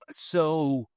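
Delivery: ordinary delivery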